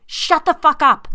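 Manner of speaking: loud, high slow scream; woman screaming at her husband by the fire. Acted. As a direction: scream each word at him. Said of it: "I screamed".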